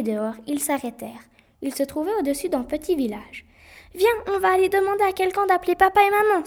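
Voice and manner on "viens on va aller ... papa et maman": put-on high, loud, fast eager boy; bubbling with enthusiasm; excited. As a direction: rush the words in relief. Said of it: "s'excita le garçon".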